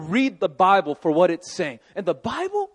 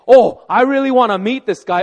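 eager; 4 semitones higher, 9 dB louder than usual. Acted. Voicing an eager would-be believer.

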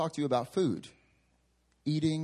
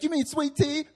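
sister's correction; 13 semitones higher, 4 dB louder than usual. Retold or imitated. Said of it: "She's like".

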